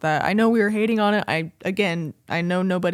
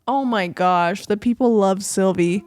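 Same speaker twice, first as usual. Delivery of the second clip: ordinary talk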